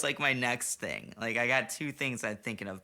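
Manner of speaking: ordinary delivery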